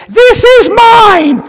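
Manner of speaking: tiny shrill ladybug, very high and slow; a bug squeaking over its claimed toy; squeaky ladybug voice. Pretend. Ladybug on the child's toy squeaks, very high and slow. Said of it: "it sends out in a squeaky voice".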